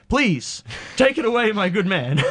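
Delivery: high-pitched, hammy gentlemanly plea; mock-grand request. Diner grandly answering the waiter offering free refills, mock-grand.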